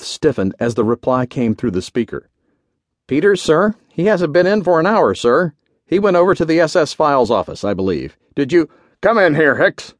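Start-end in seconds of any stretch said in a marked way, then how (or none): 3.1-8.7 higher-pitched subordinate voice, anxious to explain; flustered orderly. A narrator's reading. Orderly explains an absence over the speaker, flustered. 9.0-9.9 louder, higher summons; booming command. A narrator's reading. General cuts off the orderly and summons him, booming. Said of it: "Horner's booming voice echoed".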